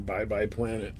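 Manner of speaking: ordinary delivery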